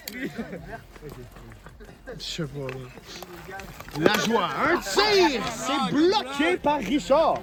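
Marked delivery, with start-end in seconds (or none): 4.1-7.4 excited. Real, not acted. Dangerous shots called live.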